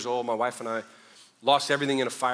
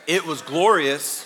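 slower, louder, with a laugh; laughing brag; 3 semitones higher, 7 dB louder, slower than usual. Shown as a demonstration. Pastor to congregation, boasting of his afro, laughing. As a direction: savour the word, laughing at himself.

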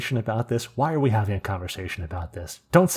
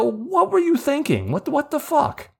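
retold exasperated disbelief. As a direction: re-enact an appalled telling-off, voice way up. Retelling his scolding of coworker.

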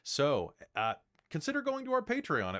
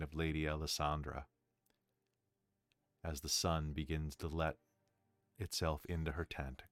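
game master narration; 9 semitones lower, 7 dB quieter than usual. A narrator's reading. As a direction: narrate the scene in a hushed low voice. GM narrating scene after play.

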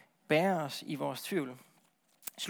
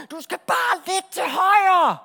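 high, slow shouted instruction; drawn-out long-distance holler; shouting from afar; 11 semitones higher, 10 dB louder, much slower than usual. Acted. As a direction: bellow directions over a great distance. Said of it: "står på afstand og råber".

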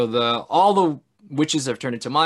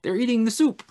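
SOUP said in a raised, high voice; high, playful echo on "eating the soup"; high-pitched repeat; 11 semitones higher than usual. Acted. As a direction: lift "eating the soup" well above normal pitch. Repeats the line for effect.

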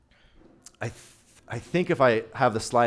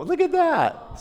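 high, squealing look at that; proud uncle gushing over a photo; delighted exclaim; 13 semitones higher, slower than usual. Real, not acted. Showing photo of newborn niece.

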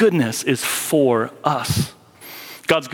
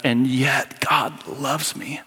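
slow, breathy whispered confession; a whispered, awed and grateful admission; hushed whisper; 3 dB quieter, much slower than usual. Acted. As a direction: let it fall to a slow whisper.

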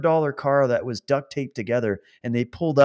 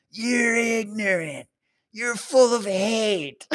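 high, slow, drawn-out mock yell; put-on indignant heckler voice; mocking an angry heckler; 9 semitones higher, slower than usual. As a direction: imitate a heckler, stretching every word high. Imitating the heckler in the car.

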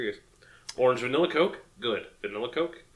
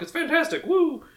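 excited cheer; 13 semitones higher, 3 dB quieter than usual. Real, not acted. Cheering about drunk vodka.

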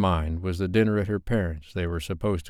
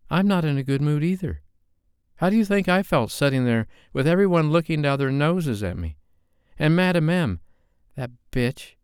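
angry Sophie; 9 semitones higher than usual. A narrator's reading. Sophie firing back at Dave after the munch, high and angry. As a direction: throw his bad mood back at him.

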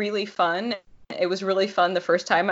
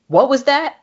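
loud, higher angry challenge; 8 semitones higher, 7 dB louder than usual. Acted. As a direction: snap back, demanding.

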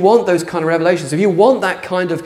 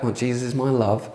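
awkward quiet version; 3 semitones lower, 7 dB quieter than usual. Shown as a demonstration. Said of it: "talking like a guy like that".

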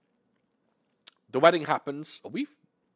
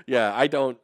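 ordinary delivery

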